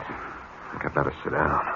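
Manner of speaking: deflated, barely voiced; stunned. Boss hearing profits halved, stunned and soft.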